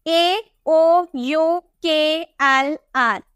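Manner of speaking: ए ओ यू के एल आर dictated slowly, letter by letter; slow emphatic listing. Shown as a demonstration. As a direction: spell out the letters slowly for students to memorise. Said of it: "क्या चीज?". (रटवाने के लिए धीरे-धीरे अक्षर)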